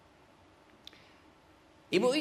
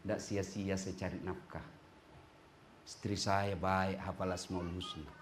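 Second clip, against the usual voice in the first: husband's inner thoughts; 11 semitones lower, 12 dB quieter than usual. Retold or imitated.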